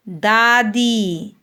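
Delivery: दादी slowly, both long vowels stretched; long vowels drawn out. Shown as a demonstration. Teacher demonstrates long vowels to pupils, drawing out दादी. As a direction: hold the आ and ई in दादी. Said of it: "उसको थोड़ा सा खींचते हैं कुछ second के लिए".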